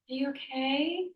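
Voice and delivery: high, soft, drawn-out question with a rising end; sympathetic checking-in tone. Retold or imitated. Colleague empathizing with someone already emotional, mock tender concern. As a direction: coo it with exaggerated concern. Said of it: "In that tone".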